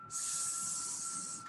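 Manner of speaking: unvoiced hissing s; hissed s sound. Shown as a demonstration. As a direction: hiss the letter s. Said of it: "What sound do you see here?".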